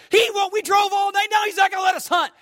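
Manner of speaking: high, loud mock-furious shout, rushing; faked fury. Ballplayer faking rage at the doctor to his buddy, hammed-up shout. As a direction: storm and yell like a cheated hunter. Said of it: "if faking anger has that kind of power".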